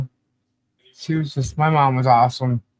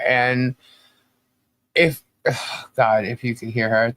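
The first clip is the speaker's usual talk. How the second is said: ordinary talk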